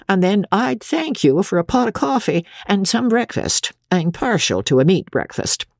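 high-pitched, folksy midwife voice; fat midwife, chatty. Acted. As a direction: chat away while angling for breakfast. Midwife addresses the mother.

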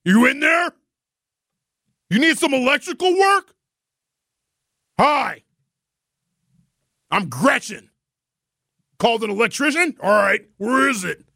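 high, brusque calling through the door; butch electrician lady. Acted. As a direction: barge in like a no-nonsense tradeswoman. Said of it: "she knock on the door like this".

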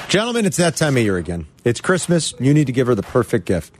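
slow, low, seductive commercial voice; sultry ad read. Acted. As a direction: sell it like a smooth romantic ad. Host reads a romantic pajama ad.

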